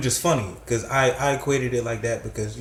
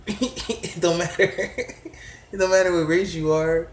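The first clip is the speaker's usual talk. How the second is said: laughing; 5 semitones higher, 3 dB louder than usual. Real, not acted. Laughing while insisting.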